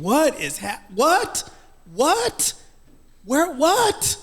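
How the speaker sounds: high, rising confused whats; a lost voice asking what is happening; mock bewildered what what; bewildered panic. Retold panicked confusion watching TV.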